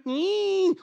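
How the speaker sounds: high mock cat screech; a short catlike shriek. Preacher recalling the cat's cry, high screech. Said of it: "Not hearing".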